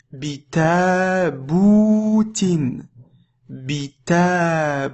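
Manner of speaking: "bitaboutin" slowly, long a and long ou; whole word drawn out. Shown as a demonstration. Teacher reading the whole word, "bitaboutin" slow with long vowels. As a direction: hold the ta and bou of "bitaboutin". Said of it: "Le mot en entier".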